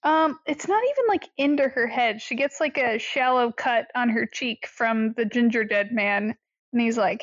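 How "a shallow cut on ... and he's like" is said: normal talking voice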